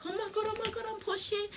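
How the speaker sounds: high panicked "oh my God, I'm pushing"; panicked squeal. Retold or imitated. Mother panicking mid-labor on the way to the bed, high squeal.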